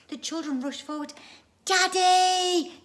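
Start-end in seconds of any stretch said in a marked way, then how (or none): 1.7-2.8 high, loud joyful call; crying out. Acted. The children calling to their dad. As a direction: shout for daddy across the plain. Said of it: "they cried".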